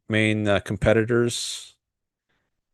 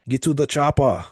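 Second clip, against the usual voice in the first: Austrian-accented line, pitched up and put on; Arnold impression; 5 semitones higher than usual. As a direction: growl the order like the action hero. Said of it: "Haha, that's actually pretty good." My, my, uh, Arnold impression".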